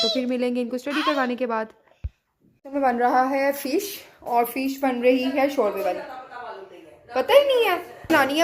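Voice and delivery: usual speaking voice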